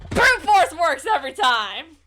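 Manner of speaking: loud, slow gloating cry; triumphant shout. Real, not acted. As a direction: crow it out in triumph. Player gloats about the puzzle.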